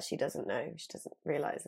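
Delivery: softly, behind her back; hushed aside. Retold or imitated. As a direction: say it under your breath to a colleague. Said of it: "they were like".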